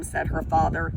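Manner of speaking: usual speaking voice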